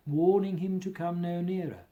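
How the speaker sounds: ordinary delivery, nothing marked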